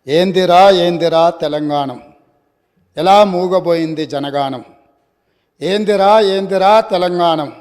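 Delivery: loud, declaimed protest verse; poetry recitation. Acted. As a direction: recite the poem out to the whole house, line by line. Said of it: "కవిత్వంతో ప్రారంభిస్తే".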